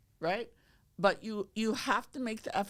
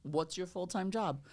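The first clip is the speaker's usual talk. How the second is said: ordinary delivery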